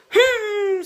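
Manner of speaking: very high, loud drawn-out "hmm"; frustrated pea's hum. A narrator's reading. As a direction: squeal a hum through closed lips. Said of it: "said the pea".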